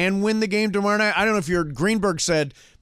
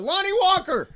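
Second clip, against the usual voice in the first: casual yelling out; 14 semitones higher than usual. Shown as a demonstration. Laker fan casually yelling out a player's name, high holler. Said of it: "I'm occasionally yelling out".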